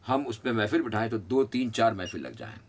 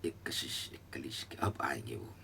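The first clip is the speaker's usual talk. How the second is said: whisper; 9 semitones lower, 11 dB quieter than usual. Acted. Teacher muses on longing.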